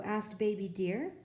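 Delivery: ordinary delivery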